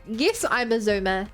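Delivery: ordinary talk, nothing marked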